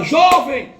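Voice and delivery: put-on woman calling to the dying young man. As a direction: shout to reach someone fading away. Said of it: "Aí ela".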